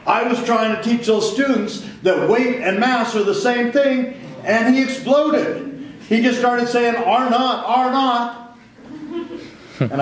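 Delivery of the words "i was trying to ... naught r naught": angry teacher. Retold or imitated. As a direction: mimic the complaining teacher, pitched up. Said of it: "And he's mad".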